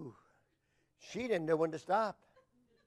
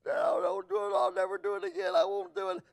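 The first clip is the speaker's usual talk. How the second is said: high-pitched mock pleading; hammed-up, high frightened plea; imitated crying son; 11 semitones higher, 3 dB louder than usual. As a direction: beg like a boy in trouble, pitched way up. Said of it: "Oh, he cried".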